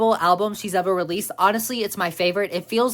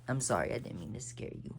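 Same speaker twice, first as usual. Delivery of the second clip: much softer, fast and hushed; soft hushed apology; 5 semitones lower, 16 dB quieter, faster than usual. Real, not acted. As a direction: gently say sorry to the startled cat. Apologizing to startled cat.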